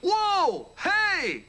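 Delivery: loud, high-pitched cries of alarm; startled yell. Acted. Host startled by sudden interruption.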